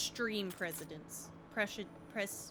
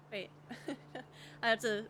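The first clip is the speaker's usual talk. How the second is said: ordinary delivery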